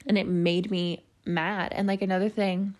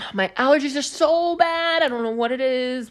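exasperated whine; 6 semitones higher, 4 dB louder than usual. Real, not acted. Complaining to viewers about bad allergies, whiny. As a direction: wail it out in exaggerated misery.

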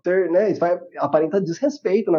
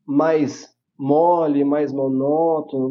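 slow and dull; 4 semitones lower, much slower than usual. Shown as a demonstration. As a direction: drag it out, slow and flat, voice sinking lower. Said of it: "Se eu falar".

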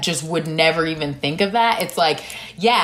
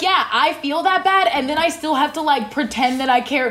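exasperated inner voice; 7 semitones higher than usual. Retold or imitated. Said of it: "It's like".

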